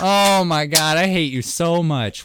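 usual speaking voice

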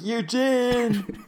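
high, long-drawn call of the name; acted calling out. Whit calling Eugene who isn't hearing him, exasperated mock holler. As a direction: stretch it out to get through to someone. Whit calls again, not being heard.

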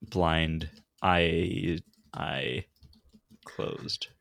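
slow, drawn-out words; talking slow. Real, not acted. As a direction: spell it out slowly. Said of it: "You're just talking slow".

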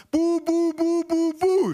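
very high, monotone falsetto syllables; aunt's piercingly high cooing cry; shrieked baby babble. A narrator's reading. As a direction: squeal nonsense at a baby. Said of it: "schrie meine Tante".

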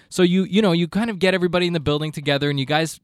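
ordinary talk, nothing marked

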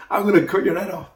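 fighting talk. Retold or imitated. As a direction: fling it at him as a fierce challenge. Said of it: "That's fighting talk".

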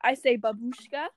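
put-on Russian accent, playfully emphatic. Shown as a demonstration. Telling a friend her word for discoveries, Russian accent. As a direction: keep up the mock Russian accent. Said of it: "I speak in the Russian accent".

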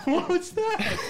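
high-pitched question through laughter; laughing. Real, not acted. Host reacts to water on his foot, laughing. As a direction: squeal it out, cracking up.

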